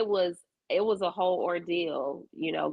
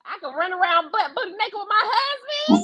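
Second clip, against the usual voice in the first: giddy; 13 semitones higher than usual. Real, not acted. Joking about empty nest freedom.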